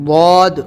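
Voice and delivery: loud, heavy "واد", drawn out; full-mouthed, thick. Shown as a demonstration. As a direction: fill the mouth and boom "واد". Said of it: "منہ بھر کے موٹا بولیں گے".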